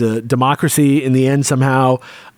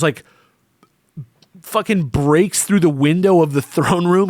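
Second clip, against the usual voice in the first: ordinary talk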